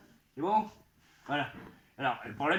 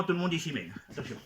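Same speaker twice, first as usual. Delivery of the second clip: ordinary talk, nothing marked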